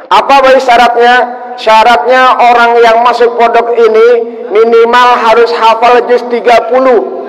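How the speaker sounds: high, loud sermon delivery; preaching at full force. Acted. Preacher voices the kiai's condition to the congregation, loud and high. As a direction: push the kiai's condition out to the crowd.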